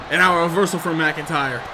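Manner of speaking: normal talking voice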